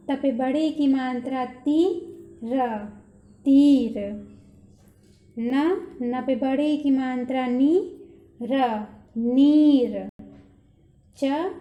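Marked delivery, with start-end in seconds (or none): 3.4-4.0 तीर with the ई stretched and loud; drawn-out long ई. Shown as a demonstration. Teacher reads तीर, stretching its long ई for the class. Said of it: "खींच कर बोलना है". 8.4-10.1 नीर with the ई held very long; drawn-out long ई. Shown as a demonstration. Teacher reads नीर, drawing out its long ई very slowly. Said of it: "खींच कर बोलना है".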